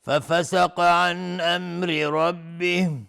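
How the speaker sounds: slow, melodic tartil chanting; Quran recitation. Acted. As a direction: draw out every verse with a flowing chant. Recites next verse melodically. (تلاوت آهسته و آهنگین قرآن)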